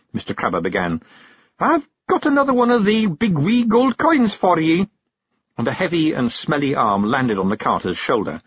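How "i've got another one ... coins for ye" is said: high, loud Scots voice; bearded passenger, coaxing. A narrator's reading. Passenger bribing the carter with gold, high Scots. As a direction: tempt him in a high Scottish brogue.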